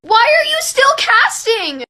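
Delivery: high, loud, drawn-out protest; exasperated. Real, not acted. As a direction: yell at the character in disbelief. Scolding the film character.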